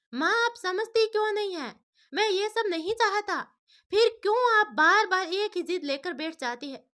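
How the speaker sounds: a grown son fed up with his mother's insistence; frustrated son. A narrator's reading. Son protests to his mother, exasperated.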